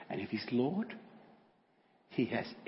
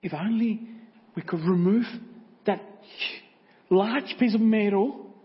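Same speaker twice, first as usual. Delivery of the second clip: tearful doctor; 9 semitones higher, 11 dB louder, slower than usual. Acted. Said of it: "tears are rolling down".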